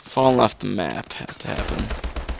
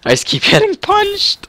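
much higher, whining; high whiny complaint; 4 dB louder than usual. Real, not acted. Gamer whining about getting punched, high-pitched. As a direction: squeak out the complaint.

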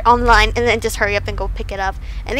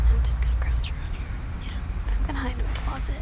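unvoiced, hushed whisper; hiding, whispering; 5 dB quieter than usual. Real, not acted. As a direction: whisper so nothing hears you. Hiding in horror game.

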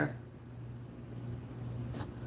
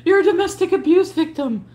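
mocking impression; 6 semitones higher than usual. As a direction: parrot her words back sarcastically. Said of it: "So all this".

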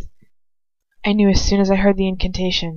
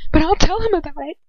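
defiant taunt; 7 semitones higher than usual. Acted. Captive defies attacker.

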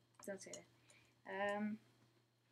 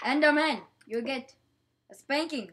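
mock scolding; 11 dB louder than usual. Scolding game mobs.

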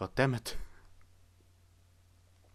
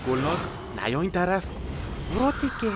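frantic shouting; 7 semitones higher, 4 dB louder than usual. Real, not acted. Yelling at monster to stay away.

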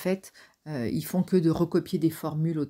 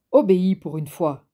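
louder barked command; barking order; 2 semitones higher, 8 dB louder than usual. Acted. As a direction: bark the order at the boy. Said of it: "aboya Ron".

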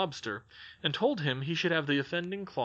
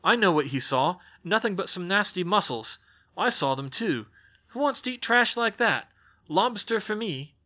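put-on young boy's scornful boast, louder, higher by the end; a cocky little brother scoffing; lightly voiced boy sneering at mussels, then slowing on the last words; boastful boy Jack; 6 semitones higher, 6 dB louder than usual. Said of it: "cried Jack".

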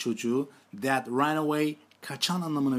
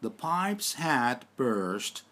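very slow, deliberate English sentence; teacher dictation pace; slower than usual. Shown as a demonstration. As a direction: read each word out slowly for learners. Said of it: "Ben İngilizcelerini söyleyeceğim şimdi".

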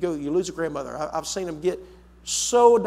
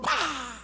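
sudden burst of a mock explosion; explosive bah; 7 semitones higher, 5 dB quieter than usual. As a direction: blurt it out like something blowing up. Acting out an explosion.

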